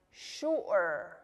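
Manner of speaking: "sure" split as shu-er by a throat catch; glottal stop. Shown as a demonstration. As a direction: break "sure" in two, shu'-er, drawn out. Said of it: "make that little stop in your throat".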